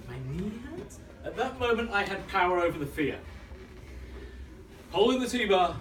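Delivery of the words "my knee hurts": small, soft, higher questioning voice; weak excuse with rising doubt; unconvincing inner voice. Acted. Little voice making an excuse, soft and unconvincing. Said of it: "it's not very convincing".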